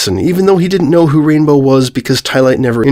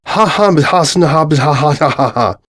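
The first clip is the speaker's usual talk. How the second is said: mock villain laugh; 3 semitones higher than usual.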